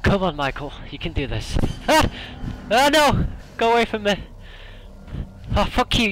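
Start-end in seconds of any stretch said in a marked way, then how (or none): 1.9-6.1 panicked yelling. Real, not acted. Jump scare.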